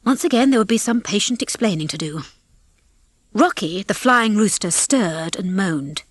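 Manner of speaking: plain reading voice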